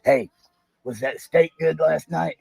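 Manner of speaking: normal talking voice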